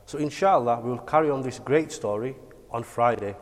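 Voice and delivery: ordinary delivery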